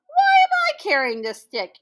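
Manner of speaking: very high, loud sudden question; squeaky realization. Acted. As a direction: squeal it as the idea hits. Anansi has a clever idea.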